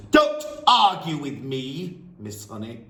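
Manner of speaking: crush the teacher's protest; thundering. Acted. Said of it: "the headmistress thundered".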